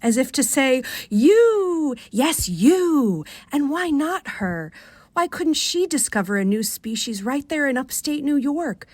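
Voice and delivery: ordinary delivery, nothing marked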